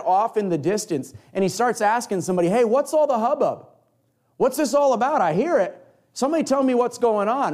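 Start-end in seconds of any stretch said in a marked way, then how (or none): 2.5-7.5 calling out. Acted. Blind man asking the crowd what's happening, calling out high. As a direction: shout over the noise to find out.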